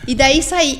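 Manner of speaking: normal talking voice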